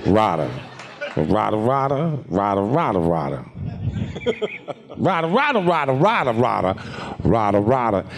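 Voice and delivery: character chanting one word over and over; repetitive cartoon character. Voice actor demonstrating a character's only word, repetitive cartoon character. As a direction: rattle it off on repeat in a put-on character voice. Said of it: "the character j-, only says "rada" over and over again".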